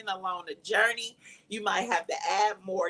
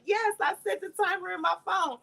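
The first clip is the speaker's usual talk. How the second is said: much higher, a touch tearful; high tearful reply; 10 semitones higher than usual. Retold or imitated. Crying patient answers her doctor, voice pitched high. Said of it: "I was like".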